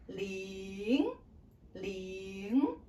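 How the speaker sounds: "ling" starts low and climbs; rising second tone. Shown as a demonstration. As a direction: draw out "ling", sliding up like a question. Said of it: "start lower and then go up".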